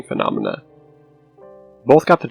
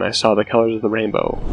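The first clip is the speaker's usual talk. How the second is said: plain reading voice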